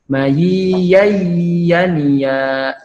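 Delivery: Mayi ya yania drawn out, the ya merged in; slow merged idgham reading. Shown as a demonstration. Teacher models the idgham for the class. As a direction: read it slowly, running the tanwin into the ya. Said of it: "hukumnya idgham, dimasukkan".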